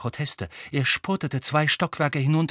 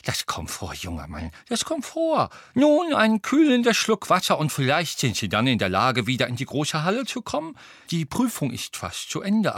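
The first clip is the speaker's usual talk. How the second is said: put-on sympathetic old wizard; 5 semitones higher than usual. A narrator's reading. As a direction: coax the pupil gently in an old man's voice. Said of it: "sagte der alte Zauberer mitfühlend".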